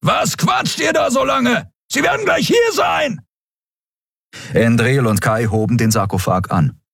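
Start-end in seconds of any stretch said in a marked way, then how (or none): 0.0-3.3 shouting from afar. A narrator's reading. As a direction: yell it across the distance. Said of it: "hörten sie Kero brüllen".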